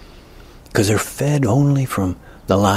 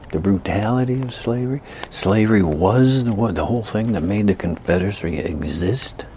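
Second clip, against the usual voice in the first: ordinary talk, nothing marked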